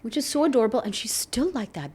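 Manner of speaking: normal talking voice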